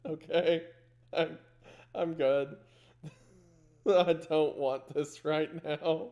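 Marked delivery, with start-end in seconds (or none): none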